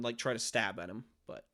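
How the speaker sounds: normal talking voice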